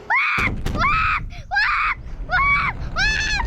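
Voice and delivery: high, loud quacks shouted out; duck quacks. Pretend. Kid in a duck game quacking loudly at friends.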